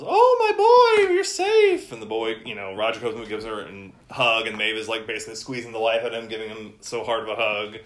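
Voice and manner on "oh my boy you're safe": high, loud relieved mom voice; relieved mother. Acted. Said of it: "Maeve ... says".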